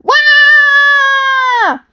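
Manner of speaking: high loud drawn-out wail of panic; a long, high, loud scream of panic as they shoot off. Acted. Both heroes wail as they zoom through space. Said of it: "they both wailed".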